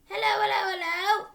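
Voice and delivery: high, loud squawky parrot voice; parrot calling. Acted. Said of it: "the bird called down to her".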